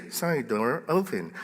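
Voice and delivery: slow, soft automated announcement; be the alarm system's voice, slowly and softly; Telus alarm lady. Retold or imitated. Pastor imitating the office alarm lady, slow robotic announcement. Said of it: "I hear this".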